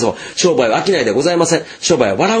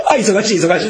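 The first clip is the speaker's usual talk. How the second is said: higher, laughing mimicry of a bar girl; imitated bar girl; 6 semitones higher than usual. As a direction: bustle in chanting a gag, laughing. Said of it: "ガールズバーの女の子が来た時さ". (笑いながら高めに真似る女の子)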